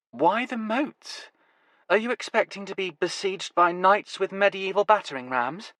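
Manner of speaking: mock-grand joke about a siege; dry teasing woman; Nevada's voice. Nevada teases Huey about moats.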